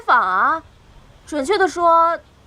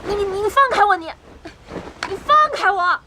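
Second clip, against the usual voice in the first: stammered, raised-pitch demand for release; indignant, higher-pitched cry; struggling protest; 4 semitones higher than usual. Acted. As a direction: fight against the captor's hold. Seized woman demands release.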